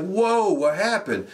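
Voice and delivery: high, hammed-up whoa; mock surprise. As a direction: act startled by the music. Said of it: "And we're like".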